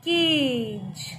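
केज with its A held long; long vowel. Shown as a demonstration. Teacher repeating for pupils to hear, long drawn-out A in केज. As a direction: stretch out the ए in केज. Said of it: "लंबा खींच रहे हैं थोड़ा".

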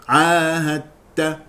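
"عاهدت" said slowly as 'aahatta, doubled t; full assimilation. Shown as a demonstration. Teacher giving the correct reading, slow "عاهدت" with tt. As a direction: merge the d of "عاهدت" wholly into t. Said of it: "والصواب إدغامه إدغاما كاملا".

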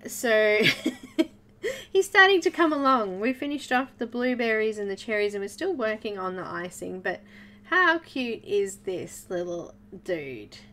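ordinary talk

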